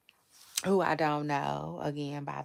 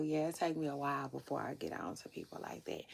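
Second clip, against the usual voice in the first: whisper; 3 semitones lower, 6 dB quieter, faster than usual. Real, not acted. Confiding aside.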